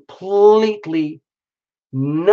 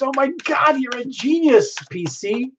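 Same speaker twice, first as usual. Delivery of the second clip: high delighted gush, much higher; excited squeal; 10 semitones higher than usual. Real, not acted. Reacting to friends wearing the scarves, high excited gush. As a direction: squeal it out, thrilled.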